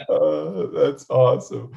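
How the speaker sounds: delighted, still laughing through it. Real, not acted. Host reacting to the test pipe joke, laughing.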